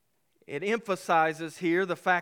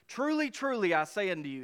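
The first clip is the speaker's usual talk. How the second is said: both "truly" words pitched high and stressed; raised, solemn double "truly"; emphatic truly truly; 3 semitones higher than usual. Shown as a demonstration. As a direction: ring out "Truly, truly" like a solemn oath. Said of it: "he's emphasizing what he's about to add".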